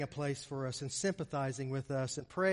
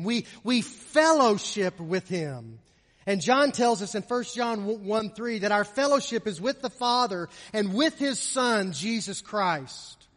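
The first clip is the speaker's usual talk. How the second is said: louder, higher, with key words stretched; emphatic preaching; 4 semitones higher, 7 dB louder than usual. Real, not acted. Preacher telling congregation of fellowship with the Son, emphatic preaching. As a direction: lift the voice and proclaim it.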